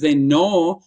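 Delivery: slow, drawn-out Minnesota "know"; Minnesota accent. Real, not acted. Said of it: "my Minnesota accent came out there".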